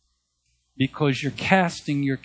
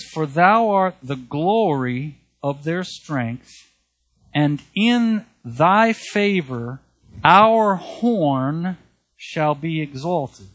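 scripture reading; 2 semitones higher, 6 dB louder, slower than usual. Acted. Preacher to congregation, proclaiming the verse slowly and loudly.

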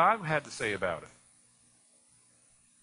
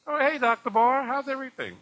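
cheery greeting; 10 semitones higher, 6 dB louder than usual. Retold or imitated. Cheerfully greeting the doctor on phone.

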